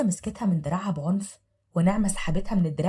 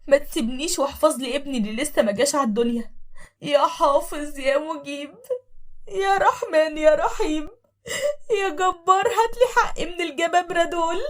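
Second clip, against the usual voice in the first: tearful prayer; 8 semitones higher, 4 dB louder than usual. Acted. Thrown-out wife praying to God, crying. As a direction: pray through tears. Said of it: "وقالت بصوت واطي".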